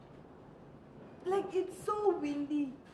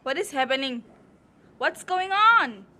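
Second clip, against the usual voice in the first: calling out loud; 10 dB louder than usual. Real, not acted. Calling to niece in wind.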